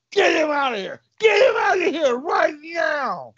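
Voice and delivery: high, loud hammed-up crying yell; mock sob shout. Cop wailing at the leprechaun, hammed-up sobbing. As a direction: wail it like a bawling child.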